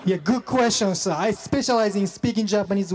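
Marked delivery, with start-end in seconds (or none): none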